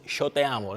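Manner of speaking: "yo" said with a sh sound, sho te amo; "yo" as Uruguayan sho; Rioplatense yo. Shown as a demonstration. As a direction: Argentine sh in "yo". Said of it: "no Uruguai, tem essa pronúncia".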